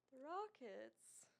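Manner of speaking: slow, dry sarcastic drawl; sarcastic, dragged out slowly. Real, not acted. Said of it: "The sarcasm in your voice when you said that".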